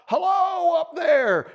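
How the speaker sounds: high, loud, drawn-out call; hollering up. A narrator's reading. Bunny calling up to a party in a tree, hollering. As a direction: holler it slowly up to the treetop. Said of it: "he hollers out".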